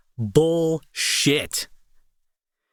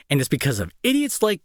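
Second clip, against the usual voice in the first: ordinary delivery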